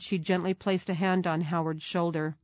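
ordinary delivery, nothing marked

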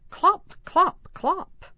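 very high, bright clip-clop; playful high trotting sound; horse hooves. Pretend. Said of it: "Lift feet up and down".

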